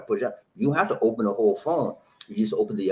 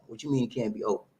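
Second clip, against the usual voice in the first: normal talking voice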